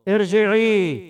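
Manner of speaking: usual speaking voice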